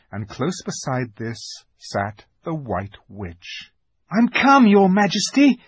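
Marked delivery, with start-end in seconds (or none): 4.1-5.6 high, loud eager announcement; keen boy reporting to his queen. A narrator's reading. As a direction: rush in with it, pitched high. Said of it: "rushing eagerly forward".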